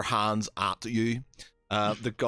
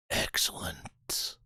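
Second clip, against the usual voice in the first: lightly voiced warlord relishing a future duel; smirking warlord; 4 dB quieter than usual. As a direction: savour the coming fight quietly. GM voices leader, smirking reply.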